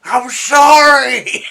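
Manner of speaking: very loud, high, stretched-out yell; over-the-top screamed impression; Adam Sandler. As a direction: belt out a mock apology, dragging it long. Imitates possessed Sandler's yelled apology.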